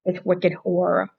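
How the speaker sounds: thick Boston accent on Wicked Horror. Shown as a demonstration. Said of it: "probably my Boston accent".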